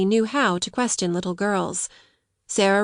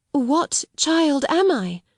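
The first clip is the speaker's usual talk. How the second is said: slow, higher-pitched hesitant question; faltering; 7 semitones higher, slower than usual. A narrator's reading. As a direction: falter like a bewildered child. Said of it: "she faltered".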